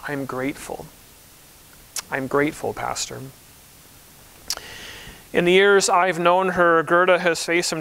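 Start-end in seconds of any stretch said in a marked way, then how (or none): 0.1-0.9 soft, hushed quote with the stress on I'm; a gentle, hushed I'm grateful, rising at the end; quiet grateful reply. Retold or imitated. Quoting a church member's answer to how she is, hushed. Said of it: "she always responds by saying". 2.1-3.2 soft, lower repeat, the stress on grateful and falling; quiet grateful reply. Retold or imitated. Pastor repeating a church member's answer, softly. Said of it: "she always responds by saying".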